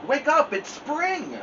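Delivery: high, bright eager call; excited. Acted. Frog urging sleepy Toad to wake for spring, excited.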